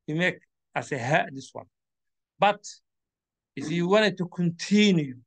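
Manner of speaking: normal talking voice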